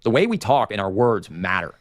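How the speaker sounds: usual speaking voice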